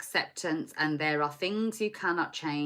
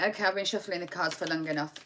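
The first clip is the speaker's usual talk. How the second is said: ordinary talk, nothing marked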